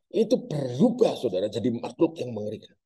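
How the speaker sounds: usual speaking voice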